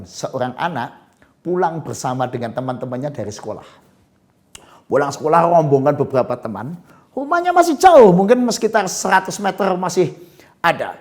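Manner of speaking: normal talking voice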